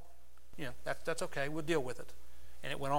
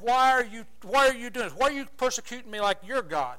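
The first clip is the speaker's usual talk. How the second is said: high, loud pained questioning; anguished protest; 4 semitones higher, 5 dB louder than usual. Acted. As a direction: cry out at your tormentors. Job protests his tormentors.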